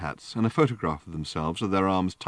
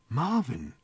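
sharp; 7 semitones higher than usual. A narrator's reading. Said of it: "said Arthur sharply".

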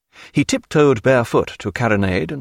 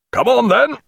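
high, loud hearty call; high loud captain's invitation; eager call; 13 semitones higher, 4 dB louder than usual. A narrator's reading. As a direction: urge him in as you dive. Captain diving in.

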